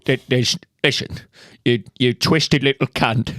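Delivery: exasperated, halting actor impression; Michael Caine. Man arguing with a self-checkout machine, Caine impression. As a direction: stammer in indignation, in character. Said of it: "Michael Caine arguing with a self-checkout machine".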